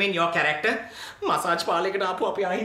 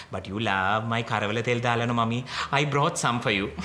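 sugary coaxing; 10 semitones lower than usual. Acted. Sweet-talking the mother.

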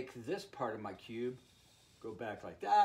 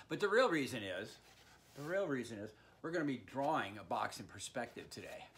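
ordinary talk, nothing marked

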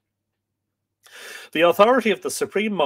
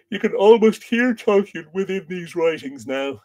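higher, louder words stretched by a yawn; talking through a yawn; 7 semitones higher, 5 dB louder than usual. Real, not acted. Reader answering a listener's comment, real yawning. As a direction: yawn while still speaking. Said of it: "Apologies for the yawning".

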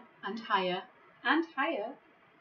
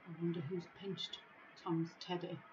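hushed aside; 2 semitones lower, 10 dB quieter than usual. A narrator's reading. Aside to young listeners.

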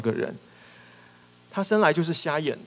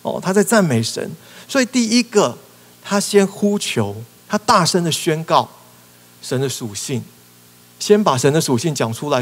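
fiery preaching; 8 dB louder than usual. Acted. Preacher urging the congregation to bold praise. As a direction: preach it out with full force.

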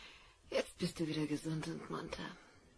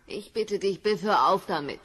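louder, pleading for him to stop; loud plea; 11 dB louder than usual. Acted. Wife begging Biff to stop insulting her son, loud plea. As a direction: beg him, raising the voice.

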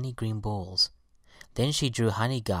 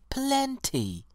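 high-pitched, joyful exclamation; delighted violet, pitch leaping up; joyful cry. A narrator's reading. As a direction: sing out your answer with delight, very high. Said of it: "cried the violet joyfully".

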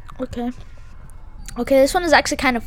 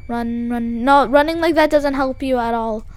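ordinary delivery

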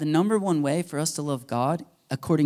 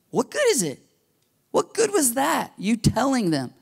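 high, exasperated rhetorical question; indignant James; 9 semitones higher than usual. Retold or imitated. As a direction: throw it up high in disbelief.

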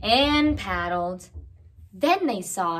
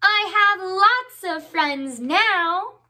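louder, slower, higher happy call; very loudly; 8 semitones higher, 4 dB louder than usual. A narrator's reading. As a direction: proclaim it across the pond. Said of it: "very loudly".

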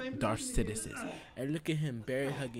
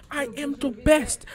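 shouted high and much louder, bragging; triumphant shout; 13 semitones higher, 13 dB louder, slower than usual. Real, not acted. Gloating over his beaten father, shouted high and loud. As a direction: yell it like you just won the belt. Victory gloating after tap-out.

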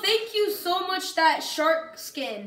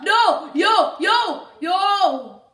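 agitated outcry; 3 semitones higher, 6 dB louder, much slower than usual. Real, not acted. Gamer busy destroying stuff, agitated outcry. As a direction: call out in alarm, word after word. Game action goes wrong suddenly.

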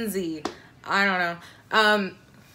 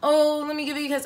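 higher, fast, playful coaxing; 6 semitones higher, faster than usual.

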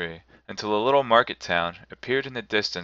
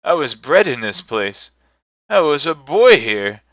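rising, loud, higher excited cry; overcome with joy; 5 semitones higher, 9 dB louder than usual. A narrator's reading. Scrooge tells the spirit of his boyhood, excited cry. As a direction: burst out in wonder. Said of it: "Scrooge recognizes his birthplace".

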